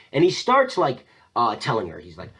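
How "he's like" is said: usual speaking voice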